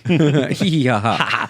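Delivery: slow, hammed-up spelled-out laugh; mock giggle; fake laugh. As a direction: laugh like a cartoon.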